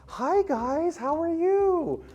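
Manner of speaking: high, bright-faced fake-friendly waitress voice; ex-girlfriend's voice. Ex-girlfriend greeting the couple at the table, high fake cheer. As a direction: chirp it way up high like an overly cheery server. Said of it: "she's like".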